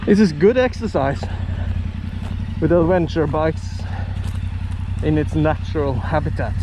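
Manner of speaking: hushed, awed wildlife documentary voice; nature documentary narrator. Pretend. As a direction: narrate the bike like a wild animal.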